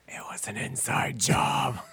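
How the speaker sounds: stage whisper. Pretend. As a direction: whisper it like a secret for the crowd. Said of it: "my stage whisper that Kyle taught me".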